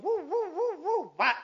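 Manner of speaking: high whooshing woo then a loud whap; mimicked slingshot whoosh and hit; sling sound effect. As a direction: whirl the sling with your voice, then smack it. Sling whirling and stone hitting.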